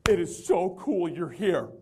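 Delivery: drawn-out, gushing welcome; slow gushing. Retold or imitated. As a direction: savor each word like a delighted fan. Excited manager greeting.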